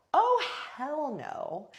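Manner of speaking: high, drawn-out "oh hell no"; acted-out offended outrage; outraged refusal. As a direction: stretch it slowly in rising indignation. Reacts to being shoved.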